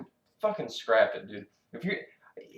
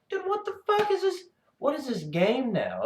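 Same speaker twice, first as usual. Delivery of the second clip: much higher, incredulous; incredulous cry; 13 semitones higher than usual. Real, not acted. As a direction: react to the chaos in disbelief. Disbelief at chaotic play.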